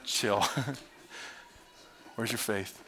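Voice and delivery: soft, low, unbothered; Jesus calmly telling panicked disciples to relax; calm reassurance. Retold or imitated. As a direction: settle them down with a chuckle. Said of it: "And he's like".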